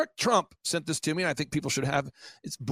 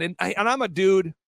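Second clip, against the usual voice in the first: ordinary talk, nothing marked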